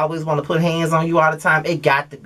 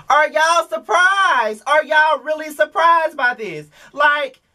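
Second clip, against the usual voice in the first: much higher, slow mock astonishment; exaggerated disbelief; 11 semitones higher, slower than usual. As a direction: stretch it out, incredulous. Mocking disbelief at audience.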